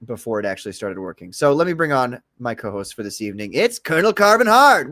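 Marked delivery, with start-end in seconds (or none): 3.5-4.9 announcer intro. Acted. Host introducing co-host on stream.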